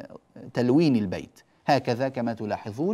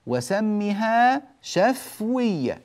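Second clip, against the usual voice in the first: recited verse; 9 semitones higher, 4 dB louder, much slower than usual. Shown as a demonstration. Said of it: "قال الناظم".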